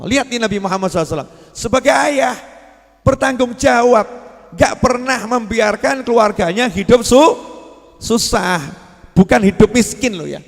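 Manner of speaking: loud, rising sermon delivery, leaning into every point; fervent preacher's declamation; full-force preaching. Acted. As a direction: preach it out to the whole hall.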